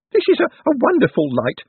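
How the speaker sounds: high, stammering wonder; exclaiming Owlswick. A narrator's reading. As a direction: marvel in a high little-man voice. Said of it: "Owlswick exclaimed".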